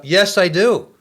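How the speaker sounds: loud, high shout straight from the gut; retold yell. Young inmate shouts his answer up to Satan, loud. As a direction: yell it up without thinking. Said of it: "I just yelled up".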